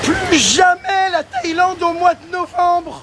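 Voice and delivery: high, slow wailing voice; hammed-up tragic wail; dramatic lament. Mock despair in cracked voice.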